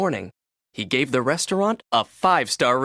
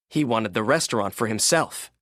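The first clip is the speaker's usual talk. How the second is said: ordinary delivery, nothing marked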